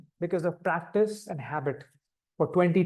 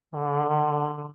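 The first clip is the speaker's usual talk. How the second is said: one long held uh; long sound; 3 semitones lower than usual. Shown as a demonstration. Teacher showing a long sound after a deep breath. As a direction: hold the uh as long as you can. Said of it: "and then deep breath".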